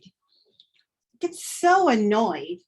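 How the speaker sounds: slow, stretched out in annoyance; drawn-out annoyed. Shown as a demonstration. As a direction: let the annoyance drag on each word.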